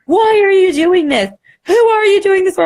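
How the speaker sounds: high, loud mock pep-talk voice, half laughing. Friend Talia on the live giving a high laughing pep talk. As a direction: fire her up with a squeaky laughing pep talk. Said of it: "She gave me a pep talk".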